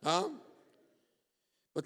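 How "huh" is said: ordinary delivery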